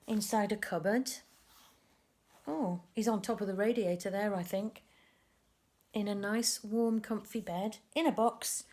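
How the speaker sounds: ordinary delivery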